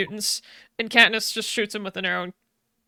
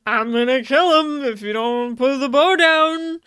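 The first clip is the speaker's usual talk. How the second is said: louder, hammed-up tough-guy threat; mock villain threat; 6 dB louder than usual. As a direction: play the bully holding a hostage, half joking. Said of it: "he's like".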